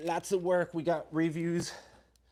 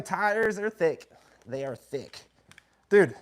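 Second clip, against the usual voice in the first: normal talking voice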